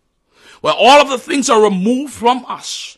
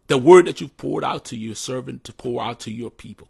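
prayer; 4 semitones lower than usual. Acted. Closing prayer.

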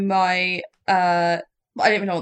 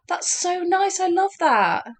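gasp, then gushing higher; delighted gasp; 9 semitones higher, 3 dB quieter, slower than usual. Real, not acted. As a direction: swoon over it, much higher. Delighted reaction to Claddagh ring.

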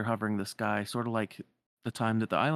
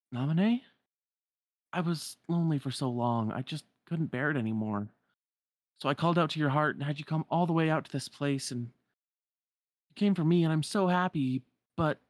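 higher-pitched, earnest pleading; confessing lonely girl; 6 semitones higher, 3 dB louder than usual. Acted. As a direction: confess why you called them here. Voices Namine confessing.

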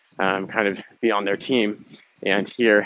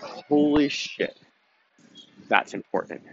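slow, drawn-out amazed thought, pitched higher; stunned realization; 5 semitones higher, slower than usual. Retold or imitated. Listener realizing the point matters, slow and amazed. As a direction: let it sink in with a slow "holy shit". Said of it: "but you thought".

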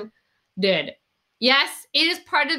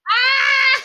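high laughing scream; laughing shriek; 13 semitones higher, 7 dB louder than usual. Real, not acted. Shrieks laughing at drunk retort.

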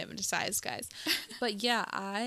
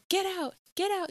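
high-pitched retold yell, only slightly louder; mock yelling; 11 semitones higher, 3 dB louder than usual. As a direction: imitate shooing out a puppy. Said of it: "yelling at it and stuff".

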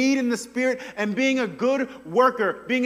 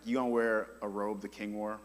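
ordinary talk, nothing marked

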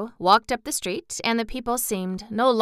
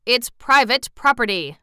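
mildly stern and emphatic; firm refusal, a little louder; 4 semitones higher, 6 dB louder than usual. A narrator's reading. Bun man guarding his town from the girl, stern. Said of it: "replied the man sternly".

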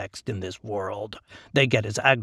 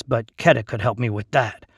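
usual speaking voice

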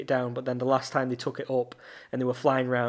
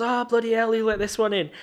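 mock exasperation; 9 semitones higher, 5 dB louder than usual. [name] teasing [name] on TV, mock groan. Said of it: "and he goes".

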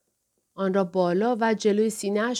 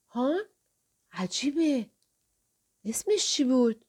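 higher, halting self-questioning; puzzled; 4 semitones higher than usual. A narrator's reading. Fusagi straining to remember his wife's name, bewildered. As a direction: puzzle aloud, groping for a lost memory.

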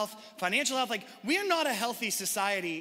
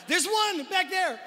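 louder, higher, pointing someone out; surprised call-out; 8 semitones higher, 5 dB louder, slower than usual. Real, not acted. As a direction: call out happily to the back. Spots a raised hand, calls out.

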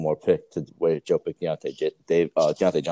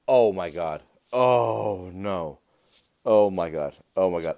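louder, alarmed oh my God; sudden realization; 5 dB louder, slower than usual. Real, not acted. Host remembering the forgotten intro, alarmed. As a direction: gasp out the realization.

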